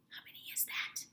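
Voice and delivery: hushed whisper behind the hand. A narrator's reading. Kid asking friend covered phone, whispering. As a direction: whisper it secretly. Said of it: "whispered to Frankie".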